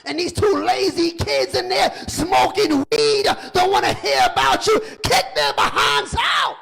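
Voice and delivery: loud, high preacher's exhortation; preaching at full force. Real, not acted. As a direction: hammer the congregation with it.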